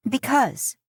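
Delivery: put-on upset girl blurting out; blurted. A narrator's reading. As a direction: let it burst out of her. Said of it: "she blurts".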